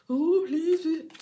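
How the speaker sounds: high, put-on begging whine; mock groveling. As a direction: ham up a pleading grovel. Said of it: "you come groveling".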